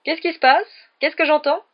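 loud, high, theatrical questioning; booming entrance. A narrator's reading. Teacher striding up to the pupils, booming. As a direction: sweep in demanding to know everything.

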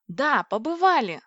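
delighted, stretch it out in triumph; gleeful. A narrator's reading. Heroine rejoicing that her theory holds, gleeful. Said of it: "радовалась я".

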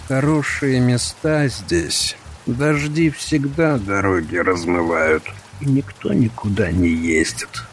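calm menacing voice. Acted. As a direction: savour it like a calm predator. Thug muses calmly.

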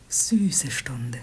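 soft, slow whisper; tender whisper. Acted. Maid admiring the sleeping prince, tender whisper. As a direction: breathe it out with longing.

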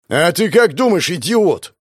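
loud scornful bark; irritated boss barking at an underling; barked retort. Acted. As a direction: snap at the fool. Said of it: "гаркнул я".

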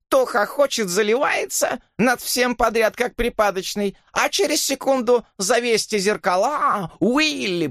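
very high, screechy exasperated rant; shrill wailing tirade. A narrator's reading. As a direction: rail at them in a shrill voice. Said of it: "визгливо завопила она". (визгливая раздражённая тирада)

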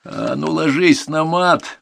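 slow, high, forceful order; angry yell. A narrator's reading. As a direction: roar the order at a pupil. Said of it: "заорал военрук".

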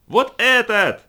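high, loud shout. A narrator's reading. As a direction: yell it over the roar. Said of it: "оба они орали во всё горло".

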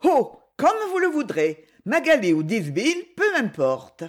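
airy high-pitched "oh", then dismissive; disdainful indifference. A narrator's reading. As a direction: brush it off with lofty disdain. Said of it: "d'un ton de dédaigneuse indifférence".

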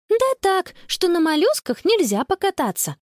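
put-on high, matter-of-fact child voice; girl explaining. A narrator's reading. Connie explaining to baffled grandpa, pointed. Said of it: "пояснила Конни".

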